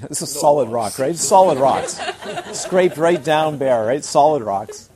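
loud laughing disbelief; laughing at the bare rock. Real, not acted. Laughing at the rocky garden.

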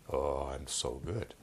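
low, slow self-satisfied voice; mock a pleased-with-himself meditator; drawn-out, deep, soft smugness; smug self-praise. Mocks self-satisfied meditator's thought.

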